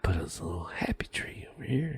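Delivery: hushed, gentle whisper; soft whisper. Retold or imitated. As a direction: breathe it out like Bob Ross. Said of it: "the original A S M R".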